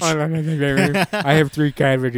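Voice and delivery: talking through a toothache, slow; mock aching mouth voice. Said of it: "I can barely speak".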